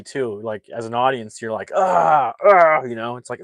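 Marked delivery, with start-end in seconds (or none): 1.7-2.8 loud, high "ah" of sympathetic pain; mock pained groan. Acting out the audience at a painful scene, mock groan. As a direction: wince aloud like a squirming audience.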